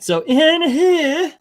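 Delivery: high, slow, loud hype; showman hype. Acted. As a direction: stretch it out like a showman. Showman opening of countdown.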